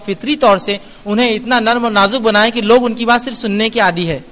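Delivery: usual speaking voice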